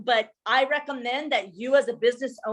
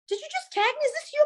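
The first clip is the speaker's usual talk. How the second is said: much higher, delighted astonishment; surprised shop owner; 14 semitones higher, faster than usual. Retold or imitated. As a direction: act amazed at being tagged. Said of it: "she's like".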